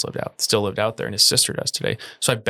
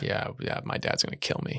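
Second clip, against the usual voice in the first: quiet; 9 dB quieter than usual. Real, not acted. Groaning about wrong answer.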